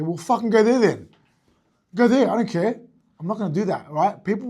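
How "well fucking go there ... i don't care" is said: higher, much louder fed-up snap; mock angry retort. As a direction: bark it like a coach losing patience. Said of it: "I'm not gonna go".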